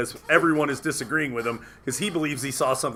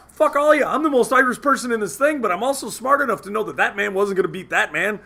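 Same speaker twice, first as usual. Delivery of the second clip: pitched-up argumentative mock rant; retold outburst; 6 semitones higher than usual. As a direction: re-enact telling the whole room off.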